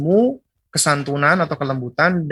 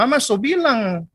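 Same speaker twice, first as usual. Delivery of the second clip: high, swinging eastern Indonesian intonation; eastern Indonesian accent; 7 semitones higher than usual. Shown as a demonstration. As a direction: mimic a Maluku parent's sing-song accent. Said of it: "dia ngomong dengan intonasi yang keras". (logat Indonesia timur bernada tinggi)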